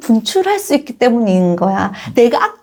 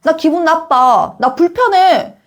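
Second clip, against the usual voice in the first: higher-pitched, firm objection; assertive child; 6 semitones higher, slower than usual. Acted. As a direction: stand up for yourself as a child. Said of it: "이렇게 말할 수 있는 게 공격성이라고".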